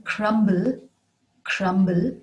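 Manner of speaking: crumble with the B clearly sounded; B pronounced. Shown as a demonstration. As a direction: say "crumble" with an audible B before the L. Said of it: "pronounce the B in".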